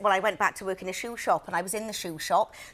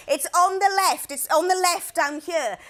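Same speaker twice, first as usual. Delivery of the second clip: high, louder mock insistence; comically frazzled, pitched high; retold addled speech; 6 semitones higher, 3 dB louder than usual. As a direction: act out worn-out, muddled directions. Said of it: "lost the use of my head and the ability to speak".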